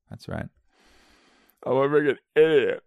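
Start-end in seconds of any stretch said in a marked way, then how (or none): 1.7-2.8 higher, louder mock outburst; hammed-up drunken self-scolding; movie-scene self-reproach.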